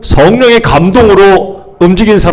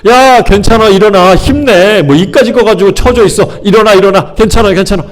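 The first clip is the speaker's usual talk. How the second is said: insistent, higher-pitched pep talk; pushy encouragement; 5 semitones higher than usual. Acted. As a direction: urge them to get up, no room for refusal. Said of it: "강요하는 거예요". (강요하듯 다그치는 격려)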